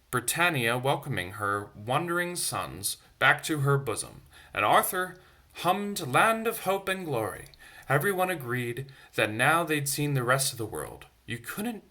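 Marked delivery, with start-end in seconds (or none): none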